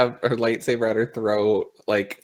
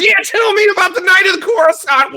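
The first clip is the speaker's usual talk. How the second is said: high, loud acted yell; explosive in-character outburst; interrogator's shout; 7 dB louder than usual. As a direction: shout the demand across the room. Interrogator yells after firing blaster.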